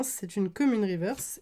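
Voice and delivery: ordinary delivery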